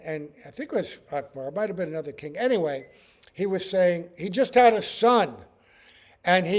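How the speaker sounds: ordinary talk, nothing marked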